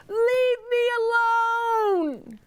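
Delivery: high, slow wailing title; anguished cry. Acted. Reading the book title to kids, as a wailing cry. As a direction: cry the title out like a fed-up mother.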